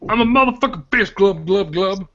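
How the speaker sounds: high gurgling fish monster boast; gurgling fish man croak. Pretend.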